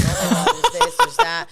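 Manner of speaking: high, loud, frantic and laughing; frantic panic. Retold or imitated. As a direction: replay the backstage panic. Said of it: "I'm like".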